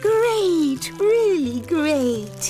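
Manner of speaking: high, gushing and thrilled; delighted girl. Acted. Golden Girl gushing to Annie Apple about the trip. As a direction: brim over with plans.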